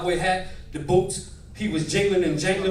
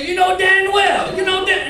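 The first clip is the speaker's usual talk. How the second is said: Southern yell; 12 semitones higher, 6 dB louder, slower than usual. Retold or imitated. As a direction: yell it in a drawn-out Mississippi accent. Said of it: "he would always yell at me".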